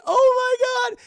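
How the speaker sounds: very high loud shriek; hammed-up shrieking panic; terrified scream. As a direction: scream as they fall. Willie screams.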